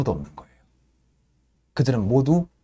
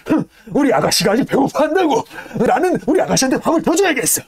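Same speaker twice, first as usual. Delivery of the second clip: much higher, scoffing and defiant; proud stoker Yank; 12 semitones higher, 3 dB louder than usual. Retold or imitated. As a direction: scoff and brag about feeding her. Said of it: "이러면서".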